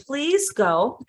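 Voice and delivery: ordinary talk, nothing marked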